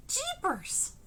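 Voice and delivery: put-on high, gee-whiz kid exclamation; eager kid, wide-eyed; wholesome 1950s kid. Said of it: "the Jeepers Mister opportunity".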